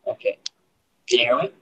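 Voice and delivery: hollow, tube-like mic sound; talking through a tube. Real, not acted. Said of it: "It sounds like you're talking through a tube".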